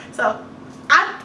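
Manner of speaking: normal talking voice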